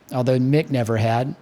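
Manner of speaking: ordinary delivery, nothing marked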